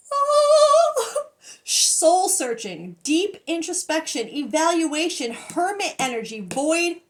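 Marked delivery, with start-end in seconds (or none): 0.1-1.3 long, high, loud held "oh"; real high-pitched cry of amazement; overwhelmed wail. Real, not acted. Overwhelmed by the card.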